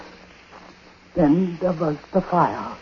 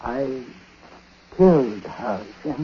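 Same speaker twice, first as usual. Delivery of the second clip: grim confession; 4 semitones lower, 4 dB quieter than usual. Acted. Dark confession.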